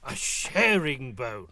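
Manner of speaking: high, slow cheerful tiger. Acted. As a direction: beam like a sly tiger.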